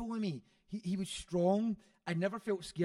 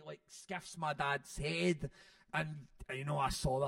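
normal talking voice

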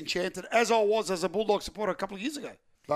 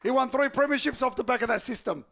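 raised, higher, forceful voice; heated arguing; 6 semitones higher, 4 dB louder than usual. Real, not acted. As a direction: argue back loudly, punching out the count. Heated argument defending Gus's record.

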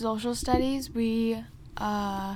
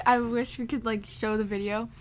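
usual speaking voice

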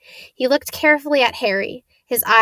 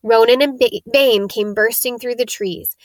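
plain reading voice

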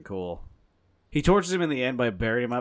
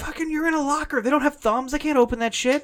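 high, loud comic outrage; exasperated rant; 7 dB louder than usual. Pretend. Gamer ranting at a character hiding in a locker, exasperated yelling. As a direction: yell it in mock disbelief.

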